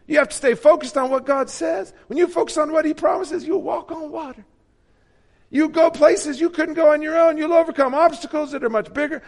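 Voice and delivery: high, loud, bright preacher imitation; mock the smooth TV preacher; Joel Osteen impression. Mocking Joel Osteen to the congregation, high and loud. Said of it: "Not many voices I can impersonate".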